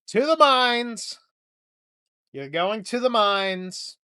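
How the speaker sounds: slow, loud mock order; mock command. Comedian sending strong guys off to the mines, slow loud mock order. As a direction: bark the sentence like a pompous overseer.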